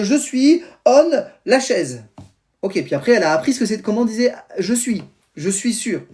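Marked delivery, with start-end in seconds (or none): 0.0-2.0 imitating his daughter. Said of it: "l'enfant, ma fille, elle dit".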